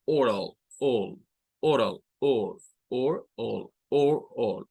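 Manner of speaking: oral split as or, all, repeated; English oral in parts. Shown as a demonstration. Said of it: "Son los dos sonidos". (oral separada en dos sonidos, or y all)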